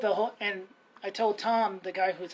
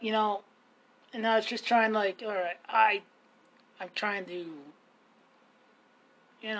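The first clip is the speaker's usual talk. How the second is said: normal talking voice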